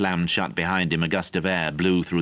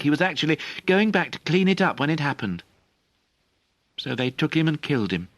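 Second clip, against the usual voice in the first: put-on higher, pressing, guilty young voice; a boy blaming himself; upset boy; 10 semitones higher than usual. A narrator's reading. Young Merlin confesses his guilt to Galapas, upset.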